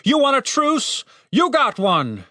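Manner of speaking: high, exasperated call; shouted offer. A narrator's reading. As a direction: throw the offer out, fed up. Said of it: "he shouted".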